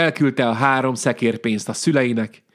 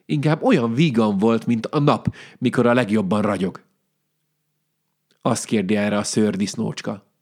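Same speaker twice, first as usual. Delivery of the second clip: usual speaking voice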